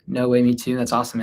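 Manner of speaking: ordinary talk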